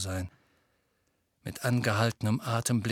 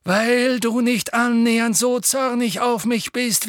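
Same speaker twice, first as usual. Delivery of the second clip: put-on firm old headmaster; 10 semitones higher, 7 dB louder than usual. Dumbledore explaining firmly.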